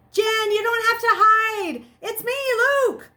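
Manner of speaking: loud high pleading call. Acted. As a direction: shout so she hears you upstairs. Searching house calling for Jen.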